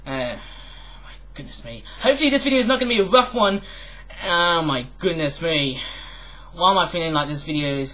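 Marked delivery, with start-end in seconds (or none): 0.1-1.8 breathless whisper, much softer; a hushed, whispered my goodness me; whispered dismay. Acted. Dismayed aside to himself. 4.2-5.8 slow, stretched-out sigh of the words; a slow, long-suffering oh my goodness me; drawn-out dismay. Acted. Dismayed aside repeated aloud.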